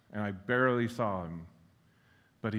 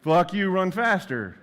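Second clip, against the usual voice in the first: much higher, much louder, slow barked order; drill sergeant yell; 8 semitones higher, 9 dB louder than usual. Retold or imitated. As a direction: yell it like a first sergeant driving a runner. Remembered first sergeant drill command.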